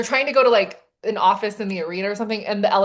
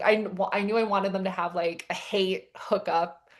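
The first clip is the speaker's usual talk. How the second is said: ordinary talk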